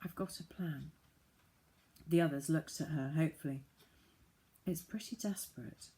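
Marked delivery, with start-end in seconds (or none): 0.0-0.9 hushed, softer voice. Acted. Sheila softly telling the others her plan. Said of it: "Sheila said very softly". 4.6-5.9 softer, hushed. Acted. Sheila softly warning the others about her plan. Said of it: "Sheila said very softly".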